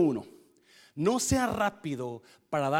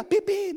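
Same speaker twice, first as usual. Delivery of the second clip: high, bright "pi, pi" horn honks; car horn imitation; 4 dB louder than usual. (imitando la bocina del carro)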